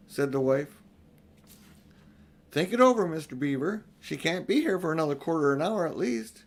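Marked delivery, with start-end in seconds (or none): 2.5-6.4 high, loud opening, then calm; a touch of a stubborn housewife; unhurried Mrs Beaver. A narrator's reading. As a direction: brush off the hurry. Mrs Beaver voiced in story.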